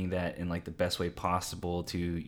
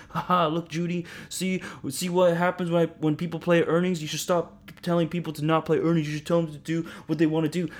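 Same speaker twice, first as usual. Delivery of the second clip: higher, mocking told-you-so voice; gloating listener; 9 semitones higher, 3 dB louder than usual. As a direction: taunt her with a smug "ha ha". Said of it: "you email me".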